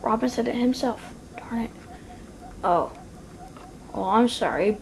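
ordinary talk

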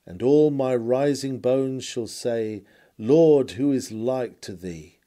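ordinary talk, nothing marked

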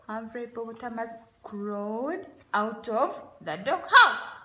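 ordinary delivery

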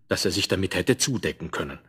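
ordinary delivery

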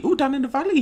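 high, lilting mock Welsh; Welsh accent. Gamer voicing an emergency call to Owen, mock Welsh accent. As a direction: put on a singsong Welsh accent. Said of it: "He's Welsh".

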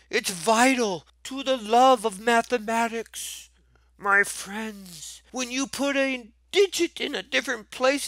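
mock emotional. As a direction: pretend to be moved to tears over math. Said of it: "That was an emotional moment for me".

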